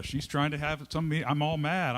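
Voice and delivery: ordinary talk, nothing marked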